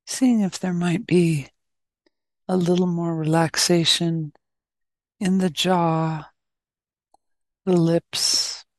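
calm, unhurried meditation guidance; short, even phrases with long silences between; guided meditation. Real, not acted.

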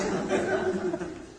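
high, squeaky giggle in the ego's voice; hammed-up high giggle. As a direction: giggle like the nervous ego. Laughing as the ego.

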